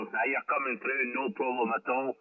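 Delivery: ordinary talk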